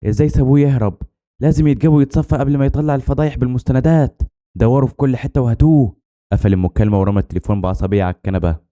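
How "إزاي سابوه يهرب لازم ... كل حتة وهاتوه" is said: raised pitch, urgent villain giving orders; restrained shout, pitched well up; angry phone yelling. A narrator's reading. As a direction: snap orders down the phone in a higher voice. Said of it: "كان بيزعق في التليفون".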